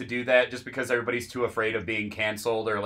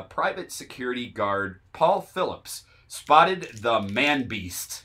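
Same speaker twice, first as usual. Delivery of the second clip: normal talking voice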